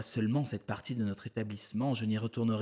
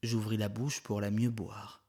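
ordinary delivery

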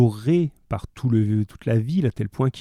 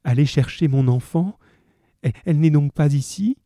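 panicked; 5 semitones higher, 4 dB louder than usual. Acted. Fantine panics about her child.

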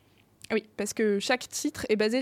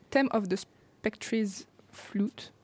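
English accent; 3 dB quieter, much slower than usual. Real, not acted. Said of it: "J'ai un accent anglais exceptionnel".

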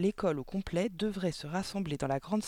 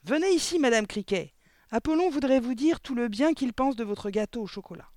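loud, high summons; projected, high-pitched announcement; calling out; 7 semitones higher, 6 dB louder than usual. A narrator's reading. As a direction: call out to someone at the door. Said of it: "cria mademoiselle Le Gourdin".

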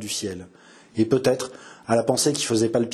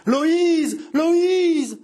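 much higher, loud anguished scream; screaming a name; 4 dB louder than usual. A narrator's reading. Mother rushes to the door screaming her daughter's name. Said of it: "en hurlant".

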